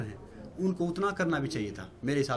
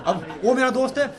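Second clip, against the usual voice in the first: laughing; 3 semitones higher, 4 dB louder than usual. Real, not acted. Laughing while retelling house chaos.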